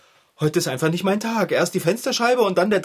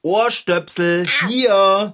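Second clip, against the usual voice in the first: loud over-articulated; 4 semitones higher, 4 dB louder, much slower than usual. Acted. Explaining loudly to earplug wearer.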